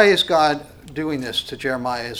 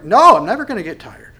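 much higher and louder, full of zeal; zealous God; 6 semitones higher, 11 dB louder, faster than usual. Acted. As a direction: burst out as a tireless, passionate God. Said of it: "you can hear the zealousness in his voice".

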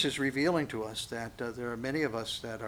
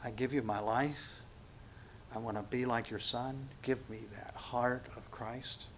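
soft prayer; 8 dB quieter than usual. Retold or imitated. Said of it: "come before the Lord and just say".

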